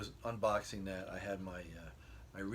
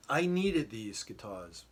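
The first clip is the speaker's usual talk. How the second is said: ordinary talk, nothing marked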